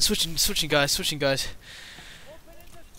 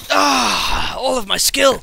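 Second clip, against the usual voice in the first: frustrated yell; 3 semitones higher, 7 dB louder, much slower than usual. Real, not acted. Frustrated outburst after dying.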